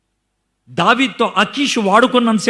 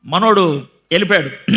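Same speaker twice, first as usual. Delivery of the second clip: normal talking voice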